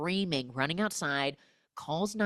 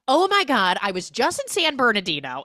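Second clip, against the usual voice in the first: much higher and louder, rushing it out; breathless excited blurt; 7 semitones higher, 9 dB louder than usual. Acted. J-Lo bursting onto the phone call, frantic and excited. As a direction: burst in without saying hello.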